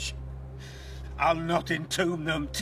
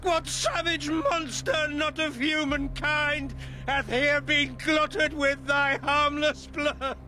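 grieving father's lament; 7 semitones higher than usual. Acted. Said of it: "to drown thee with an ocean of my tears".

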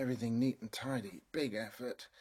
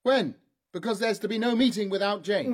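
high, loud angry questioning; angry demand; 7 semitones higher, 8 dB louder than usual. Acted. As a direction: demand answers, furious. Angry demand about child.

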